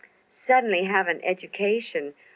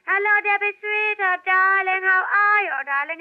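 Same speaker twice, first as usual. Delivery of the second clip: put-on even higher cooing Hungarian-accented voice; Zsa Zsa Gabor impression; 4 dB louder than usual. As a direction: push the darlings higher still. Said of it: "Zsa Zsa's".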